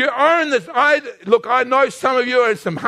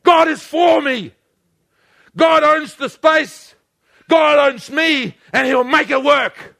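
raised, driving sermon delivery; full-force preaching; 4 semitones higher than usual. Real, not acted. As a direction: build into a ringing declaration of faith.

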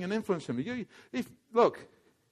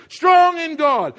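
strong in God. slow and loud, strong stretched; emphatic strong; 7 semitones higher, 7 dB louder, much slower than usual. Acted. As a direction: drive the word strong home. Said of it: "strong in the Spirit".

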